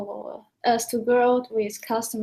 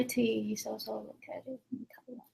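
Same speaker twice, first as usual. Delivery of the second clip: whisper; 11 dB quieter than usual. Real, not acted. Aside to host during livestream.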